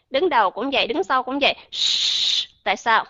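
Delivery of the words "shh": Shh hissed voiceless through rounded lips. Shown as a demonstration. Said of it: "nhớ cong hai cái môi lên".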